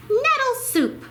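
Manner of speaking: high, bright mouse voice; squeaky mouse offering soup; proud mouse. A narrator's reading. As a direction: present the soup with pride.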